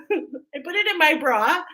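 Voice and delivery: repeating it through giggles; laughing. Real, not acted. Confessing to viewers, repeating it laughing. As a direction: laugh while saying it again. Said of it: "Don't do that".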